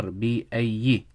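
بأي said slowly "bi-ayyi", ending in "i"; kasra ending. Shown as a demonstration. Teacher shows the harf jar's effect, slow "bi-ayyi" for بأي. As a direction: stretch out بأي with a final short "i". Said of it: "being read with a kasra".

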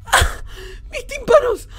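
high, loud cry of pain at the noise; pained shout. Real, not acted. Gamer yelps to viewers as a jump scare blasts his ears, high pained shout. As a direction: yell as if your ears just got blasted.

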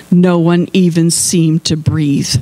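ordinary delivery

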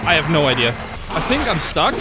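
ordinary talk, nothing marked

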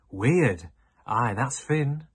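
loud, slow scoff. Acted. Boy scoffs at the missing boy to his friends, loud scoff. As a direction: sneer at the word weird. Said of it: "scoffed Charlie".

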